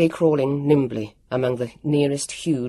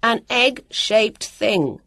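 slow, carefully separated words, pitched up; deliberate, higher calling voice; clear, precise call; 7 semitones higher, much slower than usual. A narrator's reading. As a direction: call each word out distinctly. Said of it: "Jonathan called back".

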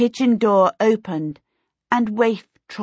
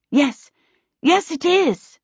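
higher, eager reply; 10 semitones higher, 4 dB louder than usual. A narrator's reading. Charmaine assuring her visitors the house is protected, eager. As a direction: jump in eagerly. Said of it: "Charmaine said eagerly".

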